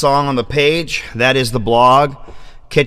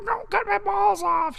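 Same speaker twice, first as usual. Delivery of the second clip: very high, soft cat voice pleading; voicing the cat; 8 dB quieter than usual. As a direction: beg like a little cat, way up high. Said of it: "she says".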